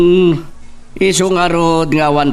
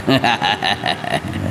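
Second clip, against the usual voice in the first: dismissive chuckle; short scornful laugh; mocking laugh; 6 semitones lower, 5 dB quieter than usual. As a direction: laugh off the danger. Don laughs off death threat.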